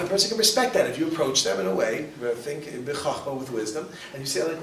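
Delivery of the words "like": ordinary talk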